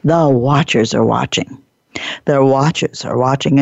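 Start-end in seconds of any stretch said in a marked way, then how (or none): none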